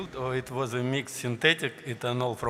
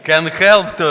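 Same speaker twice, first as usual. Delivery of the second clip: laughing; 5 semitones higher, 15 dB louder than usual. Real, not acted. Laughing through question.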